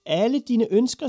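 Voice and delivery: high, loud disbelief; flabbergasted old witch; astonished. A narrator's reading. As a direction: echo it back, utterly taken aback.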